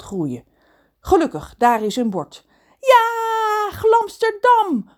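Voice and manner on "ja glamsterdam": high, drawn-out joyful yell; shouting for joy. Acted. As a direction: cheer it out, stretching the words. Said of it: "Ron schreeuwt van blijdschap".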